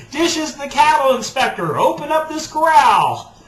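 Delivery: high, loud hammed-up cowboy demand; cowboy cattle inspector. Cattle inspector demands to be let into the corral, playful shout. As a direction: bang on the corral like a pushy inspector. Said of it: "that's all cowboy".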